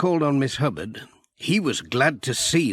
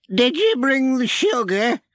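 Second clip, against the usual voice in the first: much higher, eager granny voice; old granny; 13 semitones higher, 3 dB louder than usual. A narrator's reading. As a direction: pipe up high like an old granny.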